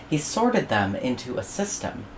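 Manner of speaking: ordinary delivery, nothing marked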